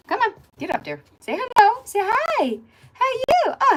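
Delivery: very high, loud cooing pet voice. Real, not acted. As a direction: coo at a tiny puppy. Talking to her little dogs.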